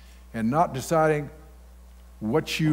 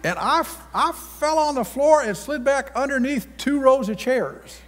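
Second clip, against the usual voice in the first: much higher and louder, carried away by the story; excited retelling; 12 semitones higher, 4 dB louder than usual. Real, not acted. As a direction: tell it with rising excitement.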